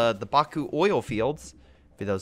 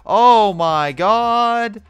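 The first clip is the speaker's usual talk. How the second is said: shocked exclamation; 9 semitones higher, 10 dB louder, much slower than usual. Real, not acted. Exasperated at game.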